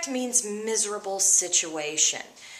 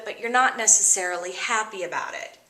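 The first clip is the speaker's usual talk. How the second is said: ordinary talk, nothing marked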